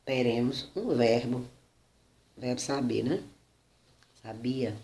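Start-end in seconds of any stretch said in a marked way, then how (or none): 4.2-4.8 Sabia stressed on BI, much softer; softly sa-BI-a; stress on second syllable. Shown as a demonstration. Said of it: "na segunda sílaba".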